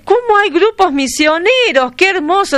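a real burst of admiring excitement; delighted surprise. Real, not acted. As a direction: gush with real wonder at the news. Host delighted by missionary groups.

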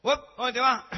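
loud high hail across the terminal; calling out. Acted. Friend at the bus terminal calls out to him, loud and high. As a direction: shout it to someone leaving.

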